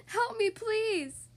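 high, slow desperate plea; crying out. A narrator's reading. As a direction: call out for help. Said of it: "she cried out".

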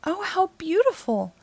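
breathy, high gasp of delight; gasped wonder. Acted. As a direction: marvel at the glowing colors. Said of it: "Firenze gasped".

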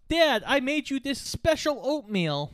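mock kid voice; son. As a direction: pitch it up like a kid showing off cooking. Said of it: "his son goes".

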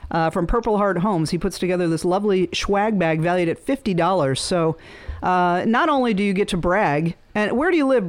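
usual speaking voice

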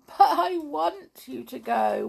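high, loud tearful wail; weeping. A narrator's reading. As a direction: plead through acted tears. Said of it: "wept poor Tiptoe".

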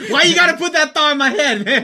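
high, loud, pained complaint; mock outraged protest. Married buddy protesting a planted thought, mock outrage. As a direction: imitate a friend crying foul. Friend protests at upsetting suggestion.

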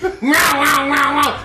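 high mock kid crying wow; hammed-up kid voice; kid's wow. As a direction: imitate a whiny kid outside. Imitating kid outside.

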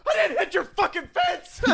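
laughing retort. Retold or imitated. His own retort to her.

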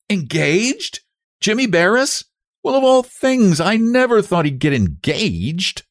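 high-pitched incredulous reaction; astonished. A narrator's reading. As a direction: react in amazement to startling gossip. Astonished at news of engagement.

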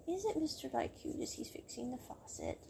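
imitating a secret thought in a whisper; whispered admiration. Yuki secretly admiring Mister Lai at the faucet, whispered. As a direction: whisper it like a private swoon.

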